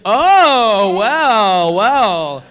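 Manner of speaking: drawn-out smug "well, well"; delighted dad. Retold or imitated. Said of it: "And he's like".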